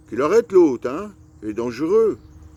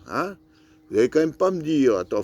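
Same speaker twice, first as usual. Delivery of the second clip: usual speaking voice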